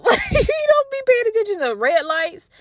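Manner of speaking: high laughing complaint. Real, not acted. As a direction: laugh it out in disbelief. Laughing about partner's driving.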